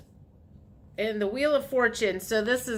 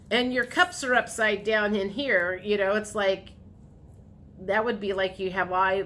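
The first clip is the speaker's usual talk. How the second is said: ordinary delivery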